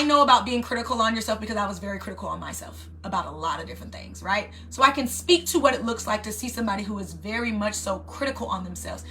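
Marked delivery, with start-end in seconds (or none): none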